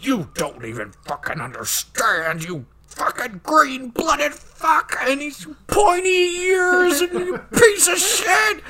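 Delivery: rising into a high, cracking laughing shout; freaking out. Retold or imitated. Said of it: "he's just freaking out".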